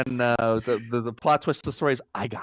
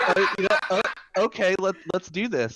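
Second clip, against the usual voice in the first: nervous, high; 8 semitones higher than usual. Retold or imitated. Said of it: "I'm like".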